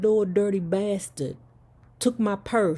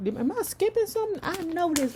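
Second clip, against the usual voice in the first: sudden surprise; 11 semitones higher than usual. Real, not acted. Interrupts herself reading.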